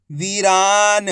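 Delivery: वीरान sounded out with long vowels; drawn-out long ई. Shown as a demonstration. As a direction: stretch वी and रा in वीरान. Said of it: "खींच के पढ़ा जाता है".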